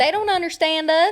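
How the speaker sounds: high, twangy lament; country accent. Pretend. Siding with co-host against celebrities, country accent. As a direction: join in with a Southern twang. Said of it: "I can do a country accent".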